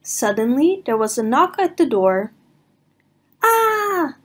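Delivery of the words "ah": high scream of fright. Acted. Everyone screams at the knock, high and frightened. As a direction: shriek in fright. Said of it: "they all screamed".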